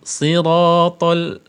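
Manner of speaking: slow, drawn-out chanted recitation; slow Quran recitation. Shown as a demonstration. Teacher recites the verse slowly for students to copy.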